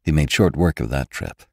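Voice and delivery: plain reading voice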